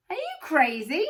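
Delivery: high, loud scornful shout. A narrator's reading. A woman standing close shouts at the tall man. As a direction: shout her down in disbelief. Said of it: "shouted a woman".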